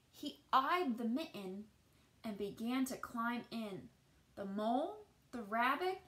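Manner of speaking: put-on gentle read-aloud voice for children; storybook narration. A narrator's reading. Teacher reading a picture book to children, gentle storytelling. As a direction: tell the tale as if to a class at storytime.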